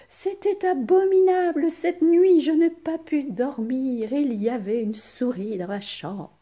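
high, loud distressed complaint; upset exclamation. Shown as a demonstration. Teacher continuing the frightened voice for pupils. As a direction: pour it out, still shaken from the night. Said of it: "exprimer de la peur".

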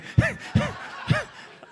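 high, loud burst of laughter. Real, not acted. Laughing at the squirrel confession, high burst. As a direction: cackle at the punchline.

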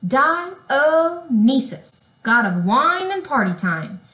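high, drawn-out name then a lively boast; party-god flourish. A narrator's reading. Wine god introduces himself to the crowd, wild and showy. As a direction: announce yourself like a party wild man.

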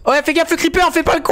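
very high, alarmed cry; high-pitched gamer panic; panicked warning. Real, not acted. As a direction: yelp a warning at a friend in sudden danger. Panicked warning about a creeper.

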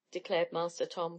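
usual speaking voice

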